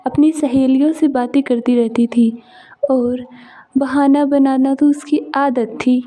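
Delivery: bold, loud storyteller opening the tale; loud story narration. A narrator's reading. Narrator introduces the tale to viewers, loud and bold. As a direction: announce the story loudly to the audience.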